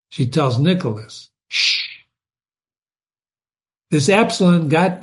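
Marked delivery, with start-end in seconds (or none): 1.5-2.0 whispered shush. Acted. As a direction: hush someone quietly. Hushing Nicholas.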